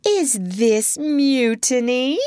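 high, slow, sweet-toned question; menacingly pleasant. A narrator's reading. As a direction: ask with exaggerated pleasantness. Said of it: "asked Hook more pleasantly than ever".

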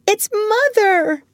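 high, slow, excited exclamation; girl hearing her mother's piano, overjoyed; joyful cry. Acted. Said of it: "cried Wendy".

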